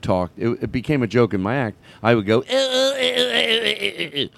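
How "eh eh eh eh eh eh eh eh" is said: high, far-off "eh, eh" impression of a friend; impression of Trent. Comedian mocking his friend Trent's way of talking, high. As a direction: squeak out his friend's stammering noises. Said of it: "do my impersonation of him".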